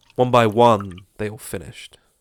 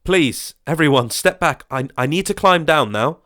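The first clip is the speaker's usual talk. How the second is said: higher, louder pleading; panicked shout; 6 semitones higher, 6 dB louder than usual. Acted. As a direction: beg them to back off, nerves fraying. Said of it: "Colin panicked and shouted down".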